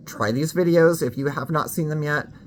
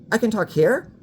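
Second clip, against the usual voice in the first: somewhat higher, less lifted take; a second, less placed try; slightly high voice; 7 semitones higher than usual. Shown as a demonstration.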